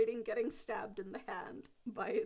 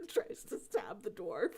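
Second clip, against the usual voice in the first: usual speaking voice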